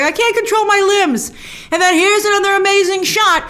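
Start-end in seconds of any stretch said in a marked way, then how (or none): 0.0-1.3 high mock panic; panicked Steven. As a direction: whine it like someone losing control of his body. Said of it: "He's like".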